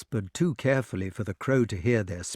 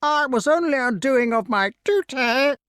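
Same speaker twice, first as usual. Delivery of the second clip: high, squawked self-defence; aggrieved bird squawk; whining crow; 14 semitones higher, 5 dB louder than usual. A narrator's reading. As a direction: protest in a whiny crow voice. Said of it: "whined the crow".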